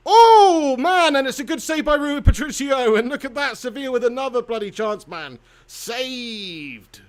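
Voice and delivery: loud, high cry easing into excited commentary; excited football watchalong call; live play-by-play. Real, not acted. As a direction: react to a near miss as it happens. Live reaction to a save.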